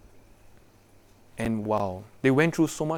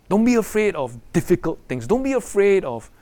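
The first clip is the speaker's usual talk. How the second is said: "Don't be afraid of difficult things" higher and louder, "be" stressed; raised exhortation; 5 semitones higher, 4 dB louder than usual. Shown as a demonstration. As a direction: call it out to the young people. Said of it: "whispered then repeated aloud".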